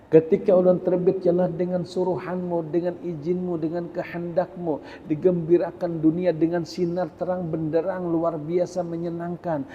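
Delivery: a lightly voiced sun complaining to God; the sun pleading. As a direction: beg Allah to be excused from rising. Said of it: "Ujar matahari".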